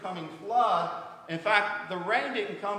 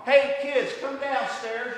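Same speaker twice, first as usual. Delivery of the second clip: put-on loud, high call to the kids; calling upstairs; 5 semitones higher, 8 dB louder, slower than usual. Father calling the kids downstairs, loud and high. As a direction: call them down from the other floor.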